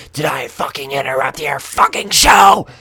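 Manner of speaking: loud, furious shout at the crowd; angry shout. Retold or imitated. Yelling at staring onlookers, furious shout. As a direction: yell it at the gawkers. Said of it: "my response is".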